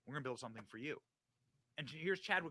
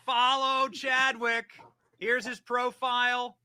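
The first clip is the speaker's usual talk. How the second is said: high, loud and drawn-out plug; loud hype plug; 8 semitones higher, 11 dB louder, slower than usual. Real, not acted. Host plugging the guest's account to viewers, loud hype. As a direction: belt it out slowly, high and loud.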